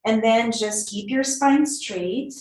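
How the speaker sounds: ordinary delivery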